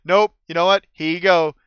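acted, emphatic opt-out; emphatic refusal. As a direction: hand it back loudly, wanting no part of it. Player's character firmly refusing the prisms.

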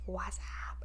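whispered. Retold or imitated. Mimicking a phone greeting to her friend, whispered. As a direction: breathe the greeting out.

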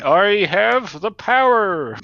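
He-Man. Acted. Player showing his idea of the barbarian, mock-heroic cry. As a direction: declaim it like a cartoon hero, stretching the words. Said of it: "more thinking like He-Man".

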